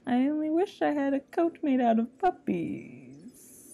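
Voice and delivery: parodying the wish, slowly; mock villain wish. Joking parody of the wish line.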